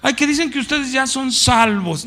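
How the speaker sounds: high mocking sing-song. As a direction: tease as the scoffers do. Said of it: "¿No se han hasta mofado de uno?".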